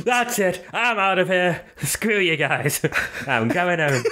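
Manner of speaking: high, whiny hammed-up huff; comic indignation, starting very high; mock storming off. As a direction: play a sulking sore loser for laughs. Mock storming-off on villain's behalf.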